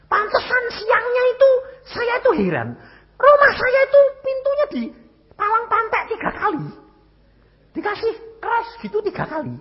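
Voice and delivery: high, strained, tearful telling; voice breaking. Real, not acted. Emotional retelling of the attack night. (suara tinggi tercekat menahan tangis)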